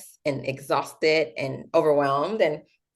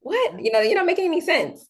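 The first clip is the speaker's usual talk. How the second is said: incredulous coworkers; 11 semitones higher than usual. Retold or imitated. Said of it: "they're like".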